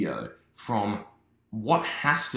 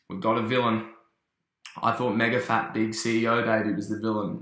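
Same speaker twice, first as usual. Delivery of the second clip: ordinary talk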